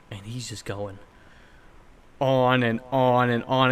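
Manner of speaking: ordinary delivery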